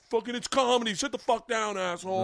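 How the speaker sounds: high mock heckle from the crowd; hammed-up crowd telling him off; mock crowd yell. Comic voicing the whole crowd scolding the guy, high mock yell. Crowd voiced yelling at man.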